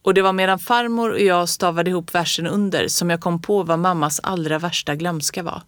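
ordinary delivery

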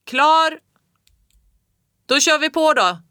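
high, loud yell of readiness; boy shouting back, ready to push; shouted back. A narrator's reading. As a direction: yell it with all your strength. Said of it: "skrek tillbaka".

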